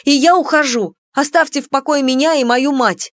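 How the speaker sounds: high, loud indignant exit. A narrator's reading. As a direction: storm off in protest. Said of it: "возмущённо воскликнула".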